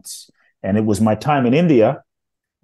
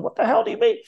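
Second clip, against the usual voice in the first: mad ego; faster than usual. Retold or imitated. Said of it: "my ego was so mad and pissed off".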